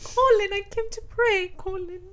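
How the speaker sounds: high, hammed-up heroine's plea; mock pleading. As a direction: plead your innocence with exaggerated drama.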